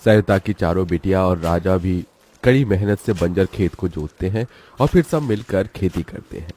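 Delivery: ordinary delivery, nothing marked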